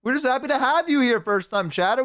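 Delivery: normal talking voice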